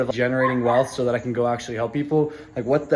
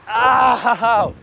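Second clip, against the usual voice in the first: pained ow; 6 semitones higher, 4 dB louder than usual. Real, not acted. Legs seizing after the race.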